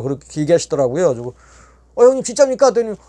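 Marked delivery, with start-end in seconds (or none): 2.0-2.7 높고 크게 튀는 확인 질문; 믿기지 않아 되묻기; 놀란 되물음. Retold or imitated. 형님 말을 믿기 힘들어함. (놀라서 높이 외치듯 묻기)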